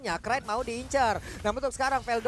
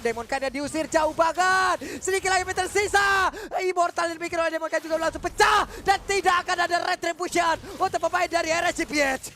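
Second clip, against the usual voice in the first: excited shouting; 5 semitones higher, 5 dB louder than usual. Real, not acted. Caster calls a big play.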